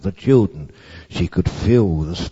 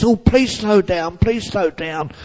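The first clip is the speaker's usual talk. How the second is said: put-on pleading child; 11 semitones higher than usual. A narrator's reading. Blind girl left behind.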